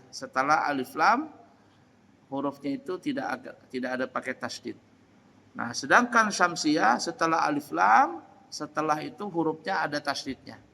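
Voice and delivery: ordinary delivery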